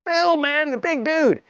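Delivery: mock panic, high and strained; high panicked call. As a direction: squeal it like a frightened kid. Yelling after fleeing friend.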